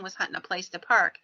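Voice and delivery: usual speaking voice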